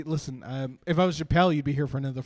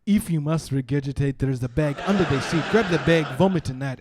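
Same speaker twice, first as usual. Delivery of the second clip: formal instructions in an imitated African accent; cab driver's African accent. African driver directing Mitchell to the bag, firm accent. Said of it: "says a big word in a funny accent".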